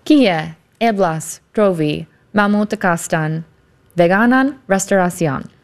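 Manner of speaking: "Kie eblas trovi malmultekostan veganan restoracion" slowly, word by word; careful repetition. Shown as a demonstration. Host copying "Kie eblas trovi malmultekostan veganan restoracion", slow careful repetition. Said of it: "ask you to say that again".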